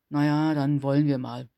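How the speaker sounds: drowsy, hoarse and reluctant; sleepy alien commander. A narrator's reading. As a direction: heave yourself up with a groan.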